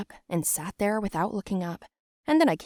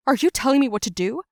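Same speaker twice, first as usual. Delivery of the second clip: loud, fast, higher retort; angry challenge; 6 semitones higher, 7 dB louder, faster than usual. Retold or imitated. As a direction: fire it back as a threat. Heated parent quarrel.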